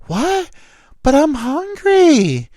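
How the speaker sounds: high, drawn-out mock whine; whining drunk woman. Drunk woman caught eating his fries, mock whining. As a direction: wail it like an indignant drunk.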